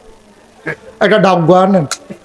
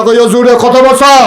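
shout; 6 semitones higher, 4 dB louder than usual. Real, not acted. Shouting at the audience.

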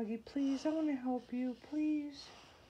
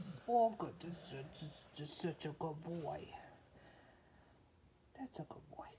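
low, soft cooing to a pet; murmured, low-pitched comfort; tender praise; 8 semitones lower, 6 dB quieter than usual. Real, not acted. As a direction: soothe with quiet praise.

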